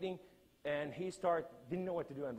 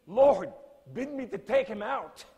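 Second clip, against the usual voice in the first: loud, high pleading cry; retold plea to God, cried loud and high; 6 semitones higher, 9 dB louder than usual. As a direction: call out to the Lord, begging. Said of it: "I'm crying to the Lord".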